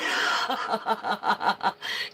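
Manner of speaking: giddy burst of laughter; excited laugh. Real, not acted. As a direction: laugh with delight. Laughs after shouting the news.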